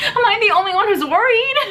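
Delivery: high, loud exasperated question; exasperated plea. Real, not acted. Reviewer asks viewers if anyone else worries, high and loud. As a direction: plead with the audience, fed up.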